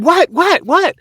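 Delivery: loud, high, repeated startled question. Retold or imitated. Man reacting to his screaming friend, loud bewilderment. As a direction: fire back alarmed over a friend's scream.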